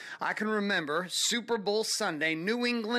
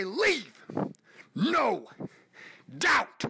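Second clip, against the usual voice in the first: coach's rallying call; 4 semitones higher, much slower than usual. Retold or imitated. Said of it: "And he would say".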